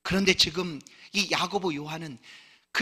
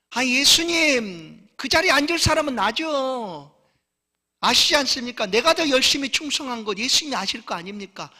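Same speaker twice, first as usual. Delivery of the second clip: self-righteous claim; 7 semitones higher, 6 dB louder than usual. Acted. A disciple tells Jesus the seat is his, loud and proud. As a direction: stake your claim, proud and pushy.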